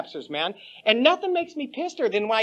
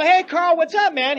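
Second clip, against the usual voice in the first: loud greeting; 6 semitones higher, 4 dB louder than usual. Retold or imitated. Greeting Carl again, louder.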